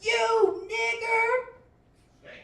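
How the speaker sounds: high, slow, drawn-out yell; yelled slur. Retold or imitated. Old lady yells a slur at driver through window. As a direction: scream it out long and high. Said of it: "she yells".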